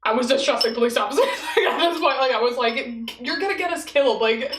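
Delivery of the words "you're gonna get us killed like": panicked plea. Retold or imitated. Scared girl begging friend in car to obey, panicked. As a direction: hurry her, scared he'll shoot.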